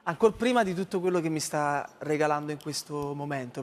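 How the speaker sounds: normal talking voice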